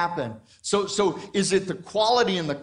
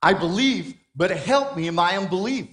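slightly raised plea to Jesus; a hint of a desperate father crying out; mild cry out; 3 semitones higher, 5 dB louder than usual. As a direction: call out the plea a touch louder. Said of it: "He cried out".

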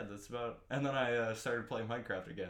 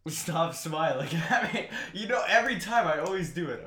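laughing; 6 semitones higher, 8 dB louder than usual. Real, not acted. Laughing while talking.

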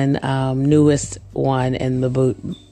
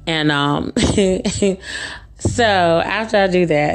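ordinary delivery

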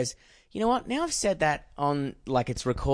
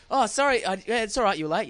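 higher, lightly voiced sheepish latecomer; mock apology; 6 semitones higher than usual. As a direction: play the apologetic late guest, half-joking. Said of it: "They're like".

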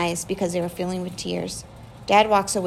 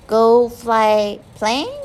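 put-on slow, louder toddler asking; little child voice; 3 semitones higher, 8 dB louder, much slower than usual. A narrator's reading. Little Penny asks Dad about flying, slow toddler voice. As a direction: sound out each word like a small child.

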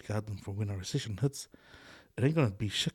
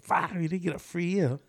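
mock voter; 8 semitones higher than usual.

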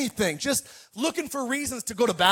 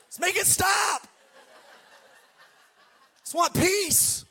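higher, pleading mock exasperation, slowing at the end; exasperated dad; 8 semitones higher than usual. Dad begging squabbling daughters to stop, exasperated and higher. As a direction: beg the kids for peace, worn out.

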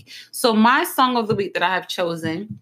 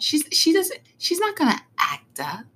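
higher-pitched imitation of an onlooker; judging onlooker; 6 semitones higher than usual. People assuming she's proper, mimicked in a higher voice. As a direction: mimic someone primly sizing her up, higher. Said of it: "they look at me like".

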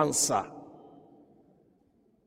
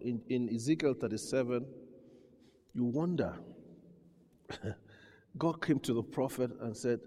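usual speaking voice

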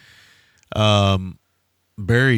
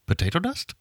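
puzzled question; 10 semitones higher, 6 dB quieter than usual. Retold or imitated. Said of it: "They're like".

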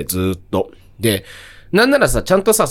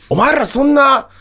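mock band frontman yelling; 11 semitones higher, 4 dB louder, faster than usual. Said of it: "ゴリゴリに詰められるっていう".

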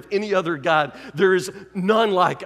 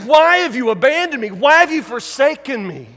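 anguished cry; 4 semitones higher, 7 dB louder than usual. Retold or imitated. Jesus on the cross crying to the Father, loud and anguished. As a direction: wail it up to the heavens. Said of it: "crying out".

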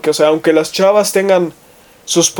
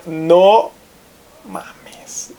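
breathy whisper on "mames", almost no voice; whispered swear. Real, not acted. Exclamación sobre su facultad. (susurro exclamativo al hablar del desmadre de su facultad)

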